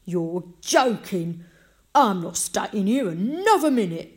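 loud, emphatic, outraged; indignant refusal. A narrator's reading. Visitor about to run out of the house, indignant. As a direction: refuse loudly, leaning hard on key words.

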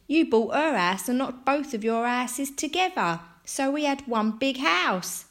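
put-on high, loud boyish delight; excited. A narrator's reading. Boys happy about the joined houses, excited. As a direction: tell it with rising glee. Boys happily recount the solution.

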